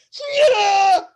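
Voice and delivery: high, loud yell of the name; anime shout. Shown as a demonstration. GM demos the player's anime battle cry, a loud shout. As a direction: call her name like an anime hero.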